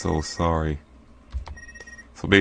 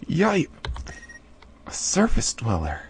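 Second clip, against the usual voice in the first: high yelp of surprise; startled cave girl; 13 semitones higher, slower than usual. Acted. Mala spotting a stranger, startled. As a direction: jump back at the sight.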